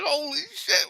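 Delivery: very high, squeaky disbelief; retold amazement. Storyteller to friend after the hit, high giddy amazement. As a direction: relive the giddy shock in falsetto. Retells own amazed reaction, laughing.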